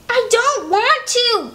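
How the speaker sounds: high, slow, sulky refusal; stubborn little girl. Acted. Little Beatrice refuses her brother at the library, high slow whine. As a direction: whine it out like a reluctant kid. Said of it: "Beatrice said".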